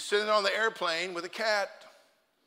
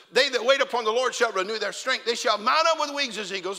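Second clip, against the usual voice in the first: high, loud preaching of scripture; preaching at full force; 6 semitones higher, 7 dB louder than usual. Acted. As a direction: proclaim the verse to the congregation. Preaching the verse.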